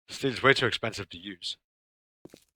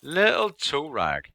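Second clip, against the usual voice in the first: slow, stretched, higher sneer at the mob; Little toe rag stretched out slow and higher, pressed on little; drawn-out mock insult; 7 semitones higher, 5 dB louder, much slower than usual. Real, not acted.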